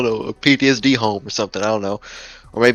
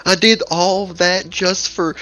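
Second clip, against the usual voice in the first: mock exasperated player; 7 semitones higher than usual. Player protesting the bad ending, in mock exasperation. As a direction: act out a let-down player's mild outrage.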